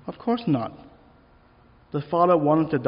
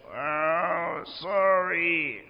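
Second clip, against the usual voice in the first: a hint of a sulky little girl, small and grudging; mild mock tiny voice of a girl forced to apologise; 3 semitones higher, much slower than usual. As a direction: squeeze the apology out like a reluctant child. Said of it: "squeeze out this tiny little voice".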